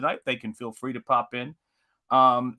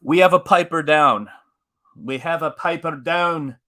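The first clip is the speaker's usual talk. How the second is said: mock-serious announcement; 2 semitones higher, 6 dB louder than usual. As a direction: announce a fallen comrade with hammed-up gravity. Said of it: "let's get serious for a second".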